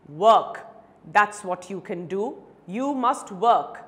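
loud, emphatic order; firm command. Acted. Advocate tells the beggar what to do, firm command. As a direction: fire it out as the answer.

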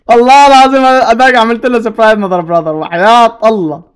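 loud, gleeful bragging; excited shouting. Real, not acted. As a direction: shout it out, thrilled with the kill. Brags loudly after surprise kill. (صراخ فرح وتباهٍ)

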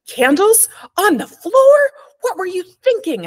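screaming at her son. Acted. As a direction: scream at the boy in frightened fury. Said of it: "I screamed".

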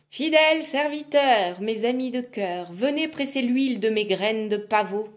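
loud incantatory summons; summoning call. Acted. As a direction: bellow for the servants. Witch summons servants.

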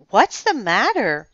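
high-pitched, emphatic asking; concerned question. A narrator's reading. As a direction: ask it with a big lift in the voice. Worried girl questions her friend.

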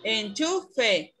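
"Enchufe" in syllables, CHU standing out; en-CHU-fe, spaced for a pupil; stronger syllable demo. Shown as a demonstration. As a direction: sound out Enchufe with stress on CHU. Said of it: "¿Cuál suena más fuerte?".

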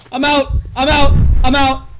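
loud, high repeated bursts; panicked yelling. Real, not acted. Player fleeing the monster, high panicked yells. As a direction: blurt it loudly in alarm.

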